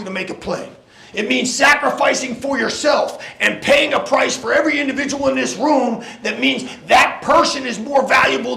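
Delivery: rising, shouted locker room speech; halftime pep speech. Acted. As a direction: fire the team up. Coach building to shouted pep talk.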